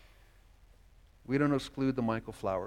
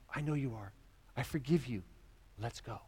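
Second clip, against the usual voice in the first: softer, tender forgiving voice; Jesus forgiving Mark, softer and tender; gentle forgiveness; 7 dB quieter than usual. Acted. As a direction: reassure him quietly. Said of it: "what's Jesus' response to me".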